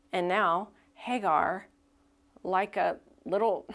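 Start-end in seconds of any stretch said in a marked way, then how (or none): none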